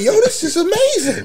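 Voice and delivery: high, laughing fake wonder; mock amazement. Telling friends about walking into Walmart, high laughing mock awe. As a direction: gush like you discovered paradise. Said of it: "I'm like".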